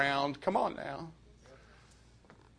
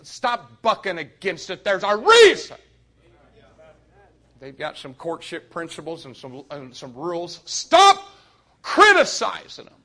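much higher and far louder, shouting preacher; preaching at full force; 5 semitones higher, 15 dB louder than usual. Acted. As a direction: drive it home, pleading with the teens.

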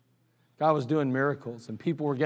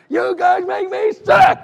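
put-on furious yell at the boys, very high and loud; angry shout; 10 dB louder, slower than usual. Sunday school teacher yelling at fifth and sixth graders, furious shout. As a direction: blow up at the unruly kids. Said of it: "He's like".

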